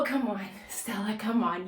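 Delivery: ordinary talk, nothing marked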